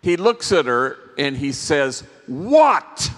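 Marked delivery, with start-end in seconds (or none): 2.3-3.1 put-on loud, high, exasperated teenage shout of "What?". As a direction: bark "What?" at your mom like an irritated adolescent. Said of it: "knows exactly what that is".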